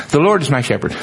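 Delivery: quick, offhand "The Lord is my shepherd." in one breath; glanced over. Shown as a demonstration. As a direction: rattle it off the way people skim it. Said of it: "we glance over it".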